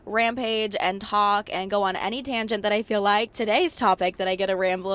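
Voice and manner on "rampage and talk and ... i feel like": normal talking voice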